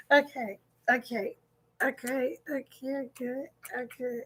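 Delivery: very soft, spent murmur; hushed, collecting yourself; composing herself. Real, not acted. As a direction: softly talk yourself down after laughing. Composing herself after laughing fit.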